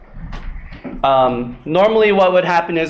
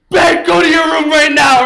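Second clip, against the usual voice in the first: much higher, loud mock shout at her son; scolding mom; 10 semitones higher, 7 dB louder than usual. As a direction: order him to his room, fuming. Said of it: "my mom is like".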